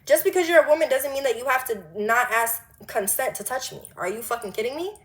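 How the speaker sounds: ordinary talk, nothing marked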